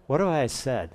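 acted uneasy murmur; quiet worry. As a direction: think it softly to yourself. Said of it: "It gets really quiet".

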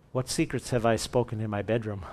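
low, hushed acted thought; quiet worry. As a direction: wonder uneasily under your breath. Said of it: "It gets really quiet".

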